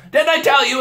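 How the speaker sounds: high, loud eager reply; triumphant told-you-so; excited beaver. A narrator's reading. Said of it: "answered Mister Beaver".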